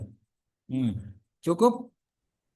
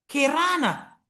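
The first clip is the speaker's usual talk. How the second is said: kerana in a Malaysian accent, with the vowels swapped to ke-ra-na and a high pitch; 8 semitones higher, much slower than usual. Shown as a demonstration. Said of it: "orang Malaysia mengatakan".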